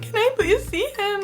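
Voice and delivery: pleading. Retold or imitated. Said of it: "I'm like".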